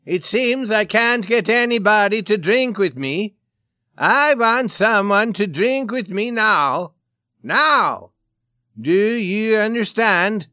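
loud, high, insistent shouting. A narrator's reading. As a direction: demand it at full voice, drawing words out. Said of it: "he shouted".

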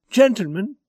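high, loud, strained appeal; controlled fright. Acted. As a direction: appeal to them while fighting down fear. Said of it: "an apparent struggle to control his fright".

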